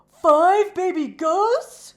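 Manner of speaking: high, loud frightened question; scared horse, loud and high; alarmed cry. A narrator's reading. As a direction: gasp it out in alarm. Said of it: "cried Coco".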